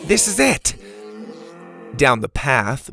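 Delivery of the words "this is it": high-pitched excited announcement; urgent exclamation. Acted. Bo stopping at the dinos' gate, urgent exclamation. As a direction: announce the find to the group.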